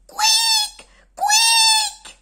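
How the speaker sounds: very high, very loud mouse squeak; shrill shouted mouse cry. Acted. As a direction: squeal like the loudest mouse in the world. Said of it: "criait-elle".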